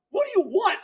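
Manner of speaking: very high, mock-emotional squeal; overwrought retort. Husband retelling his reply to his wife, high overwrought squeal. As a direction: squeak it out, overcome.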